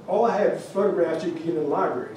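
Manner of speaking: plain reading voice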